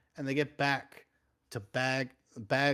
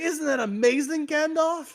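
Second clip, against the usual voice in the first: very high and louder, a silly put-on voice; giddy high squeak; 13 semitones higher, 5 dB louder than usual. Frodo chirps at Gandalf in a very high, silly squeak. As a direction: beam like a delighted fool. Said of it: "And Frodo's like".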